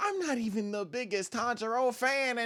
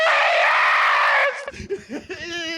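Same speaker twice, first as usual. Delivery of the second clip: long, loud, high yell; hammed-up anguished howl; drawn-out mock scream; 8 dB louder than usual. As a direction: scream it out at full volume. Acting out Eren's scream.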